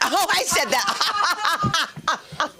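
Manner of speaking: high, drawn-out words breaking into laughter; laughing realization. Real, not acted. Comic realizes the joke was their own, laughing hard. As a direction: squeal it and collapse laughing.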